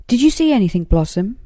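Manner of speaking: high, loud call upward; shouting up. A narrator's reading. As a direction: shout up into the tree. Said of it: "shouted Paws".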